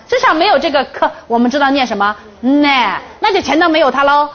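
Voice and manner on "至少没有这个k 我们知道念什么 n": normal talking voice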